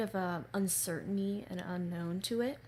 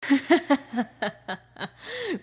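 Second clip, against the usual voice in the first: loud, high gloating villain laugh; evil laugh; 7 semitones higher, 12 dB louder than usual. Acted. Villain gloating over weakling wolves, loud evil laugh. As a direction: cackle over the beaten wolves. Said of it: "a lot of sinister tones".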